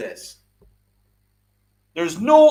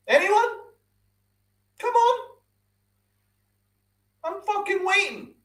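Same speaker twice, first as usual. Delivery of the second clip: higher, sing-song taunt; mocking challenge; 13 semitones higher than usual. Real, not acted. Streamer mockingly daring chat to name a good postseason. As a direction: dare them to answer, mocking.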